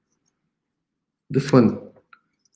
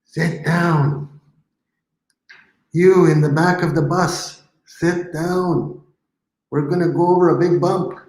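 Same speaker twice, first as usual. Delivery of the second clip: bus driver; 7 semitones higher than usual. Pretend. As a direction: boss the kids on the bus, in fun. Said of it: "pretend bus driver".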